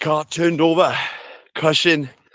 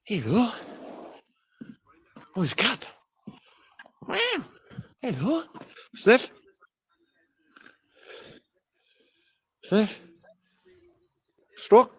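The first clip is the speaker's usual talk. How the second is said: pet talk; 9 semitones higher than usual. Real, not acted. Cooing to a cat.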